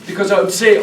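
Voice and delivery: ordinary talk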